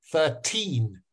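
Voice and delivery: put-on thirteen, the teen hit harder and held; thir-TEEN, weight on the end; stress on second syllable. Shown as a demonstration. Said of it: "which one is noisier?".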